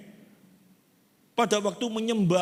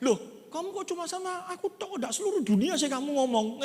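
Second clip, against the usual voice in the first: higher, whiny protesting voice; complaining disciple; 7 semitones higher than usual. Acted. Disciple questions Jesus, higher and whiny. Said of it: "Salah satu muridnya ngomong".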